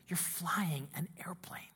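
whispery, savoured phrases; hushed awe. Acted. Preacher reliving being alone in the air, hushed awe. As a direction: half-whisper it in wonder.

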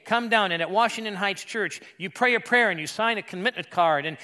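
loud, high-pitched altar-call style; forceful, insistent pulpit delivery; impassioned preaching. Acted. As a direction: belt it out like a revival preacher.

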